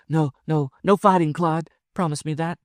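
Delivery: high, urgent woman's voice; alarmed, pleading woman. Acted. Wife begging her lover not to fight, high and urgent.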